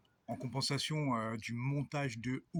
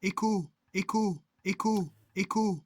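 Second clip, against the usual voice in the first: canyon echo; 8 semitones higher, 9 dB louder than usual. Pretend.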